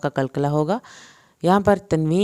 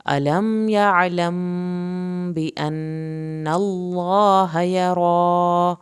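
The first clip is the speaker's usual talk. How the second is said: slow melodic Quran recitation; 2 semitones higher, 3 dB louder, much slower than usual. Shown as a demonstration. Teacher to students: whole verse in slow tajweed chant. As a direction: chant steadily with long vowels.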